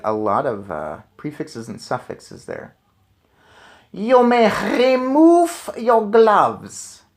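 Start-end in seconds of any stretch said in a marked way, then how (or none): 3.9-7.0 put-on high, very slow, loud witch shout in a harsh foreign accent; Grand High Witch shouting. A narrator's reading. Witch leader ordering the room, slow booming shout. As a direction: stretch the command out like a rasping hag. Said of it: "she shouted".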